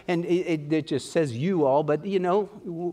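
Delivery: normal talking voice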